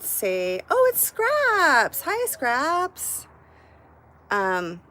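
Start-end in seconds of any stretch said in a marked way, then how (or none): 0.7-3.3 much higher and louder, slow delighted hello; light up as a friend shows up; excited greeting. Real, not acted. Greeting a viewer arriving in chat.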